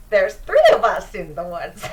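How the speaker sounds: blurted out, breaking into laughter; a loud excited squeal through giggles; laughing Mimsy. Acted. Mimsy bouncing and telling the party, laughing loudly. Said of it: "cannot do my good Mimsy voice".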